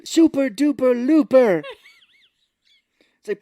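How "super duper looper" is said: loud, high, slow proclamation; hammed-up announcement. Santa announcing the rescue stunt, loud slow proclamation. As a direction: belt out the stunt name.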